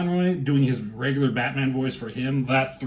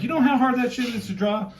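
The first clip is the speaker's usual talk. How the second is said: much higher, mock protest; high-pitched complaint; 10 semitones higher than usual. Guest joking as a character to the artist, high-pitched mock complaint. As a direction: pitch it way up as a comic gripe.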